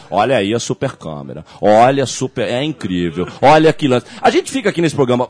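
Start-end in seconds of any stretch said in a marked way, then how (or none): 0.1-4.1 low-pitched mock sports narrator; bossa nova-paced commentator. Host mimicking TV crew praising the super camera, languid announcer voice. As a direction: parody a commentator cooing over the replay. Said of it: "nesse ritmo bossa nova".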